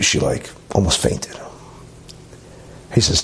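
ordinary talk

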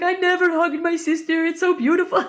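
high, hammed-up weepy voice; playful imitation of a man crying; imitated tearful speech. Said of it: "tears streaming down his face".

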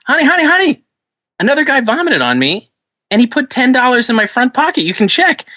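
high, pleading husband voice. Acted. Husband pleading with angry wife, high and pleading.

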